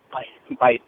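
usual speaking voice

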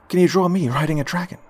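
put-on high, loud eager little boy; a kid begging with excitement; high excited child voice. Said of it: "the boy excitedly asked".

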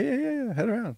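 high excited yeahs. Retold or imitated. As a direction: pitch it up eagerly. Worker voiced in story.

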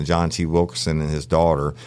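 ordinary delivery

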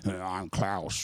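gross, creepy low groan; put-on creepy villain voice; Klaus. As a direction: drag it out slow and creepy, low and soft. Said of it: "Just sound gross and creepy".

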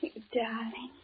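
tender, nearly voiceless whisper to her boss; hushed lover's whisper, barely audible. Acted. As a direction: breathe the confession softly. Secretary whispers love to boss.

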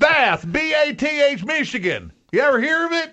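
loud, high spelling-out for a listener; insistent mock caller. Caller spelling Bath for China, mock insistent voice.

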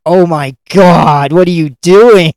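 loud, drawn-out dismay; mock exasperation. Coach watching his friend blunder, mock exasperated wail. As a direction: wail it slowly, imitating an appalled coach. Acting Steve Martin's exasperation.

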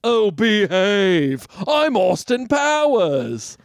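very slow, drawn-out Austin Powers voice. Acted. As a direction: ham the spy's catchphrase. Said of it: "in the words of Austin Powers".

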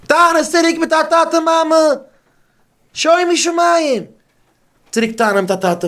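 high, loud mocking yell; mock shouting back. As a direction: throw it back like a defiant kid. (הויך און הילכיק, ווי א חוצפהדיקער קינד)

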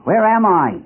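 high-pitched repeated cry; acted bewildered cry. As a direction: call it out urgently, falling at the end. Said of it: "he cried".

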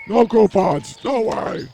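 loud, high mock denial; loud teasing no; mock refusal. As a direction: slam the door on him, joking.

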